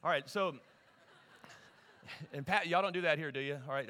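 normal talking voice

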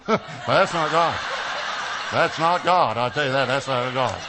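loud, high-pitched protest, acted out; hammed-up shouted rebuttal, stretched slow at first; loud denial. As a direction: shout it down in comic refusal. Acts out loudly rejecting the voice.

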